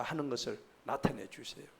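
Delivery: ordinary delivery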